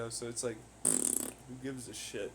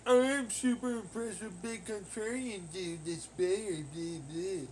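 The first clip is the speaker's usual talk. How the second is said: higher, slow sneering mock voice; 5 semitones higher, 3 dB louder, slower than usual. Ridiculing an insecure man's thoughts, higher and slower. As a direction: mimic him slowly, pitched up and sneering. Mocking someone's insecure thinking.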